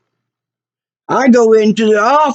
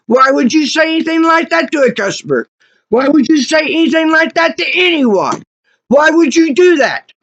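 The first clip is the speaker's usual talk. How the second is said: higher-pitched outraged questioning; indignant; 5 semitones higher than usual. Real, not acted. Customer who was told to catch covid, indignant. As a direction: demand an answer, affronted.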